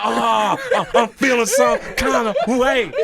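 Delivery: loud, high wail of hurt; high-pitched mock upset; comic hurt outrage. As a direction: complain loudly, hamming it up. Retells hurt feelings with comic outrage.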